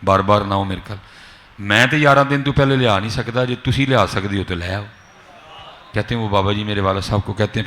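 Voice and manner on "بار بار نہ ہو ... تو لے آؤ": normal talking voice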